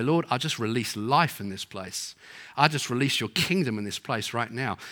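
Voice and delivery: a preacher modelling a quiet prayer for the congregation. Acted. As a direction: pray over the room gently. Leads a prayer.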